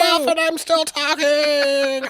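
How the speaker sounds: put-on high slow kid voice; child voice. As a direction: squeak like a little kid. Joining child voice.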